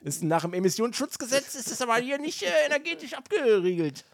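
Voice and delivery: pedantic inner voice. Retold or imitated.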